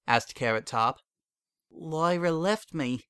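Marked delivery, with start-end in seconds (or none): 1.7-3.0 slow, higher mare voice, emptied of feeling; numb, like nothing matters anymore; cold and flat. A narrator's reading. Heartbroken mare tells her friend Lyra left, cold and flat. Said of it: "in a cold and flat tone".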